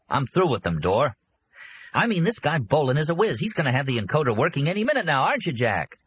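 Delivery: chirpy businessman making introductions; eager host Arnie. Acted. Arnie boasts about Bolin.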